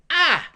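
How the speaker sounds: teacher isolating the vowel ah; vowel sound ah. Shown as a demonstration. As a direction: belt out the open ah. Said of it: "that's the vowel sound ah".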